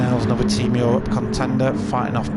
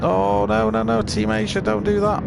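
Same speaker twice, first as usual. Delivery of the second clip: alarmed cry; 9 semitones higher than usual. Real, not acted. Commentator watching a teammate clash, alarmed pleading. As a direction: pitch jumps much higher, pleading no no no. Team driver crashing into teammate.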